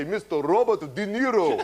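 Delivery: slow, high, laughing announcement; hammed-up awards announcement; mock announcer. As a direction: announce the winner's name with a grin. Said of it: "they say".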